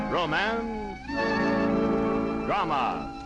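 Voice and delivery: normal talking voice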